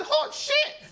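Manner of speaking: thrilled to hear his full name said right; ecstatic shout. Retold or imitated. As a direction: shriek it in pure delight. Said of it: "I said".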